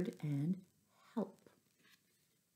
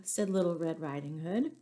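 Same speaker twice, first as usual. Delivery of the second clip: plain reading voice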